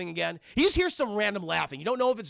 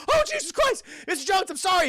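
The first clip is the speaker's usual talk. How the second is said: high, loud, startled yelp; mock panic, voice jumping way up; panicked apology; 12 semitones higher, 6 dB louder than usual. Startled man apologizes to Missus Jones, high panicked yelp.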